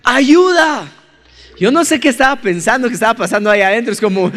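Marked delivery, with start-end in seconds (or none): 0.0-0.9 higher, louder call for help; trapped in the bathroom, yelling for help; retold cry for help. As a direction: shout for help through a locked door. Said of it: "Entonces empiezo a gritar".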